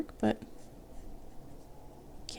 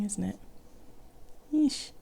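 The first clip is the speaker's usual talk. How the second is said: normal talking voice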